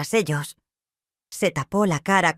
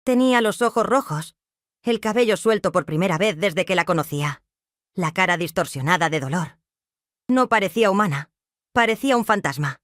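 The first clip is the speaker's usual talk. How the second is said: ordinary delivery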